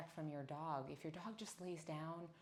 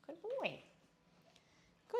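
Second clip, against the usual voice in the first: much higher, bright praise with a falling tune. Real, not acted. Trainer praising River on release, high and falling. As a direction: reward him with a lifted voice.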